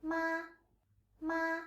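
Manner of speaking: "ma" held high and flat; Mandarin first tone. Shown as a demonstration. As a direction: sustain "ma" on one high note, no wavering. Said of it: "It is high and flat".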